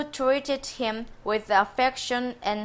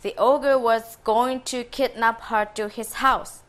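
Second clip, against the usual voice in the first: usual speaking voice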